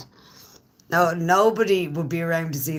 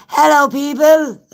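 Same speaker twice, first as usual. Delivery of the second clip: high, loud holler to the room; big high-pitched shouted greeting; calling out; 9 semitones higher, 9 dB louder than usual. Real, not acted. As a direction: call out loudly to everyone, pitch up high.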